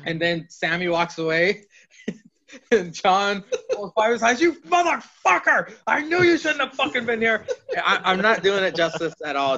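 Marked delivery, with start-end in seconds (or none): 4.4-7.4 high-pitched hammed-up rage; retold outburst, comically enraged; mock furious. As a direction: act out an over-the-top telling off, voice jumping way up. Retold furious telling off of Five.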